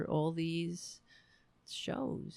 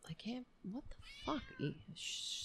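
hurried, breathless whispered curse; 13 dB quieter than usual. Real, not acted. Host cursing at the cat mid-stream, hushed whisper. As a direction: swear under your breath so no one wakes.

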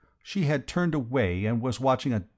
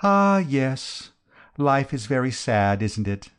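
sighing sadness; 4 semitones higher, 4 dB louder, slower than usual. A narrator's reading. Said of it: "She unshipped a sigh".

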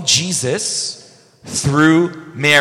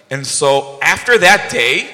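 usual speaking voice

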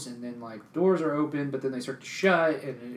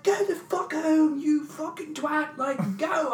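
high, slow hammed-up British scold; Monty Python English; 5 dB louder, slower than usual. Mock British cop telling the drunk to go home. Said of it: "talks like the Monty Python character".

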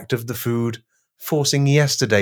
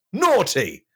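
scolding; 9 semitones higher than usual. Acted. Scolding like a dog.

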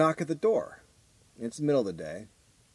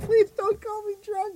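high, slow, put-on voice of the girl; mock girl voice; 3 dB louder, slower than usual. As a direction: squeak her request in a high voice. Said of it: "mimicking the girl's line".

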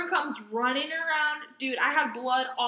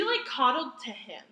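ordinary talk

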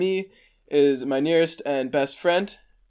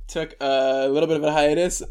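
ordinary talk, nothing marked

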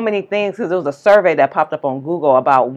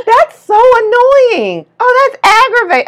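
very high, drawn-out complaint; mock outsider voice; 4 dB louder, slower than usual. Airport traveler griping over luggage, high mimicking voice. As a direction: imitate them in a high put-on voice. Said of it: "no other culture says irritating".

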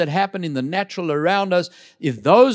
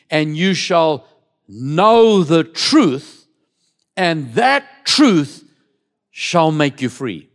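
slow, louder preached verse; slow loud scripture; 6 dB louder, slower than usual. Acted. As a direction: weigh each word of the verse, slowly and loudly. Reading scripture slowly, loud.

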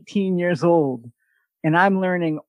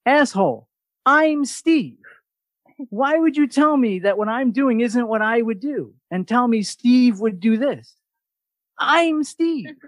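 mock indignant; 7 semitones higher than usual. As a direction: protest that he is Steve. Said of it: "And I'm like".

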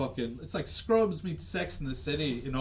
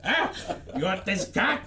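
high hammed-up seducer voice, much higher; McDreamy parody; 5 semitones higher than usual. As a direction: ham up a sleazy dreamboat.

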